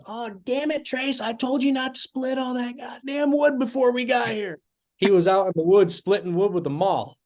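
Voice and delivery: high, exasperated wife voice; scolding wife. Retold or imitated. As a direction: scold him, pitched high. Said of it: "And his wife goes".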